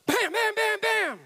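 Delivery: loud, high shouted bams; sudden booming knock sound effect; imitated pounding knock. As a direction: shout out the hammering on a door.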